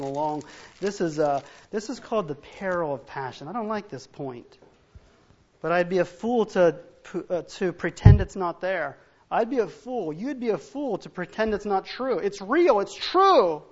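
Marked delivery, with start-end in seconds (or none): 5.6-13.6 louder, insistent warning; rising emphatic warning to the congregation; preaching at full force. Acted. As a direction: drive the warning home.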